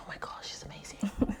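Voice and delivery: hushed, breathless fan whisper; awed whisper. Retold or imitated. Mimicking awed fans.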